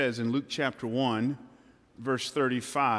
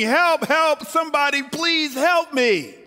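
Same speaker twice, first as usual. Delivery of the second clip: high, loud cry for help; man dangling from a branch shouting much higher and louder; yelling for help; 11 semitones higher, 6 dB louder than usual. Acted. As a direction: shout up from the cliff, desperate. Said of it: "he's yelling".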